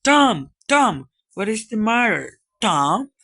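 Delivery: loud, high worried calls; alarmed calling. Acted. Sid shakes his groaning brother, alarmed calling. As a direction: call out in growing concern.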